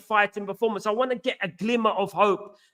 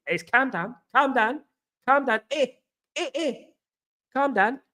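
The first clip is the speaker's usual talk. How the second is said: mock calm down; 5 semitones higher than usual. Mocking Scouse catchphrase.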